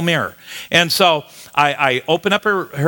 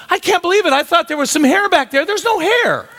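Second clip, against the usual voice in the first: hammed-up disbelief. Retelling his shock at the bald spot.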